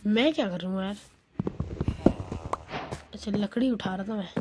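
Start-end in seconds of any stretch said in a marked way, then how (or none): none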